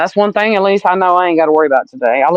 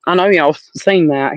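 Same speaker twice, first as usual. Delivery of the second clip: ordinary talk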